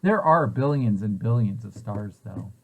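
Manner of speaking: ordinary talk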